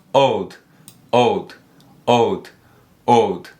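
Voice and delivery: put-on "old" drawn out slowly, a bit low; teacher drilling "old"; pronunciation drill. Shown as a demonstration. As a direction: model "old" over and over. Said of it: "Agora sozinho".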